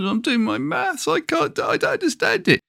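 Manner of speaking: put-on high child's voice, fretting over homework; upset little boy. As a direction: play the seven-year-old close to tears. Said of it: "He said".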